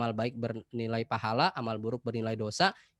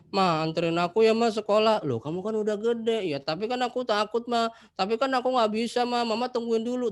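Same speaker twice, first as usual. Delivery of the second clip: put-on whiny child; 9 semitones higher, 4 dB louder than usual. Anak SMA merengek minta diantar.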